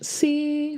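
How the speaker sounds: C held high and level. Shown as a demonstration. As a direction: sing C on a steady high note. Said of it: "very high pitch".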